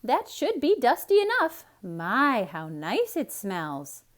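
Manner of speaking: high, loud satisfied maid; proud of the work; Amelia Bedelia voice. A narrator's reading.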